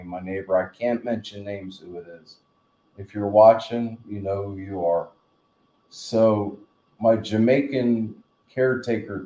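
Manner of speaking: ordinary delivery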